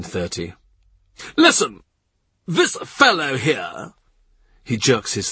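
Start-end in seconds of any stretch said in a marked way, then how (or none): 1.4-3.9 sharp high panicked cry, then slow; Norfolk panicking. A narrator's reading. Norfolk warning the courtiers, panicked and high. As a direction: blurt it out in alarm, then drag it.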